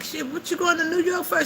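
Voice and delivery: high-pitched retold disbelief; mock-astonished high questioning; incredulous question. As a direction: imitate being baffled, voice way up high. Acts out questioning the coach.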